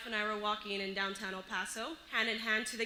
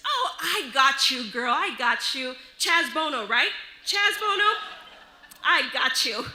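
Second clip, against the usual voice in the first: loud, chummy imitation with punchy repeats; knowing restroom woman; 6 dB louder than usual. Woman mistakenly sure she understands me, loud and knowing. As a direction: insist loudly that you totally understand. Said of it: "look up and down and says".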